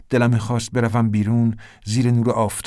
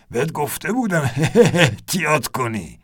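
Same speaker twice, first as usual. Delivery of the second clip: higher, laughing through the words; 5 semitones higher than usual. A narrator's reading. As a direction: tease him while still laughing.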